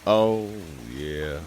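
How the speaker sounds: ordinary delivery, nothing marked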